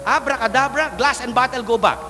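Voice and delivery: high, grand magic command; magician's incantation. Acted. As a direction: proclaim it like a stage magician. Magician casts the trick.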